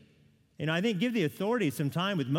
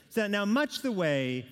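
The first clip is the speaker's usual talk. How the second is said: ordinary talk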